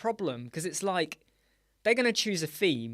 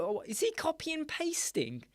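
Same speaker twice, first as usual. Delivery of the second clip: higher, wondering aloud how he did it; replaying his own puzzled thought, pitched up; surprised inner thought; 10 semitones higher, 4 dB quieter than usual. Host recalls wondering about Mark's trick, surprised and higher. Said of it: "I thought".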